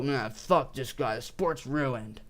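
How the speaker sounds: low-key, slow dismissive quote; dismissive mock grumble. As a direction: drag it out like a fed-up hater. Quotes his old dismissive reaction.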